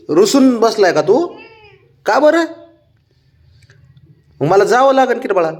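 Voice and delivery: high, fast cooing to the dog. Real, not acted. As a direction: coo at a sulking pet. Baby talk to sulking dog.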